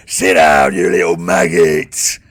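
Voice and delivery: low, drawn-out roar; angry guard shouting. Acted. Guard running to the cell door, angry low shout. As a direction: roar it like a brutish jailer. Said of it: "this guy running to the door and goes".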